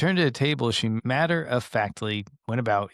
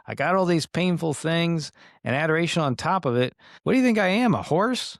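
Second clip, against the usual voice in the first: rising, louder indignant mock complaint, peaking on the horse question; exasperated complaint; 6 semitones higher, 3 dB louder than usual. Mother Angelica complaining to Jesus about painful things, exasperated. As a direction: huff it out like a fed-up nun.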